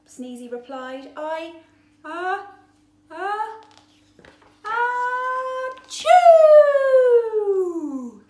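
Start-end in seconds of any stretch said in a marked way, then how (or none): 1.2-8.2 building uh-uh then loud achoo; huge sneeze. Acted. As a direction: explode into a sneeze.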